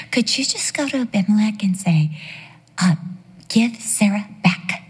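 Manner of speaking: whispered, softly pleading request; mock timid whisper. Mocking a timid prayer, soft whisper.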